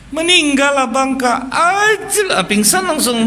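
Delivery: high loud hammed-up lament; mock wailing. Women visiting the widow, hammed-up high wailing. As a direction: wail out the bad news theatrically.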